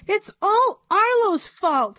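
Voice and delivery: tattle on Arlo; tattling girl. A narrator's reading. Know-it-all girl blames Arlo, high tattling voice. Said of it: "said Andrea".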